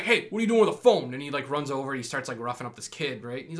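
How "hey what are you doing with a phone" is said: put-on high, fast, loud bark at the kid; thug yelling. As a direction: rush in and bark the question. Thug yells at kid in movie.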